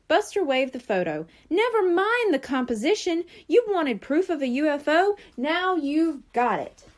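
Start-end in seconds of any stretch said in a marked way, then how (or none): 1.5-6.8 higher-pitched, emphatic insistence; insistent excitement. A narrator's reading. Buster waving his UFO photo at the Brain, insistent. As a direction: press the point, slowing down at the end.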